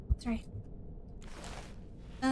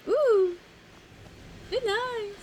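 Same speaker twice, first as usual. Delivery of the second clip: mock high reaction; 11 semitones higher, much slower than usual. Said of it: "I was like".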